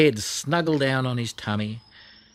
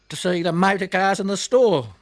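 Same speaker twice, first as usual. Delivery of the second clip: ordinary delivery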